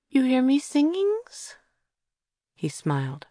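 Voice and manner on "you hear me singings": put-on small child's voice. Child Joe asking.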